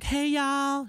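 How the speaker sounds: put-on high Southern drawl, Southern accent greeting; Southern Belle accent. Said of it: "Southern Belle".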